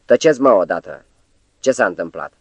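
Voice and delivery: plain reading voice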